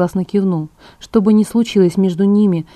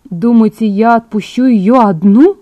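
louder, slowed incredulous question; astonished; 3 semitones higher, 6 dB louder, slower than usual. A narrator's reading. Edward protests at letting her go alone, astonished. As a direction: stretch the question in disbelief. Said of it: "изумлённо воскликнул Эдвард".

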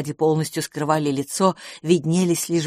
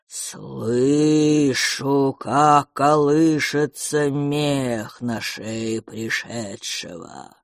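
lower, slow, drawn-out creature voice; slow cold whisper; 6 semitones lower, much slower than usual. Acted. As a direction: stretch each word like resin. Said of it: "Разлетелось тихим холодным шёпотом". (медленный холодный шёпот)

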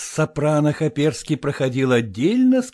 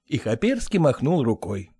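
ordinary delivery

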